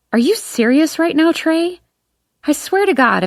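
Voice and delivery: high, indignant disbelief; outraged. Acted. Auburn outraged at comment.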